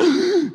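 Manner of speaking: sharp, loud mock gasp; hammed-up gasp of alarm; startled gasp. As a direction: suck in air as if watching a near fall. Mimics watching goats on a cliff.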